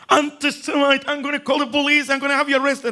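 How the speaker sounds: high, loud outraged yell; screaming. Retold or imitated. Man in the doorway yells at a suspected antisemite, furious shout. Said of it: "He started screaming at me".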